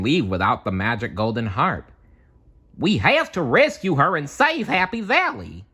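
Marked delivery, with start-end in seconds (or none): 2.8-5.6 Goofy voice. Acted. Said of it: "Goofy said".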